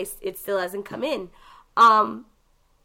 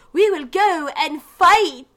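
higher, louder, slow mock-Victorian heroine; bad Victorian voice; 7 semitones higher, 7 dB louder, slower than usual. Mimicking the game's lame Victorian voice, hammed-up. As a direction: ham up a failed Victorian lady voice. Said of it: "trying to be Victorian and just doesn't pull it off".

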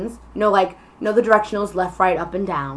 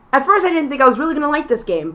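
ordinary delivery

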